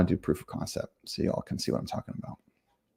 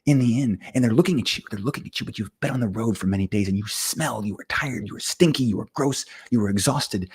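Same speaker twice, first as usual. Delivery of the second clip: game master narration; 3 semitones higher than usual. Acted. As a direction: paint the inn for the table, unhurried. GM describes the player's state.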